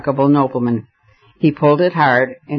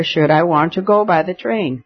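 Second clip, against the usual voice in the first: plain reading voice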